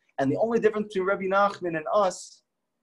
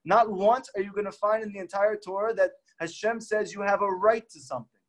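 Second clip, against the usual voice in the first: ordinary talk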